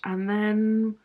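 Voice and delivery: ordinary talk, nothing marked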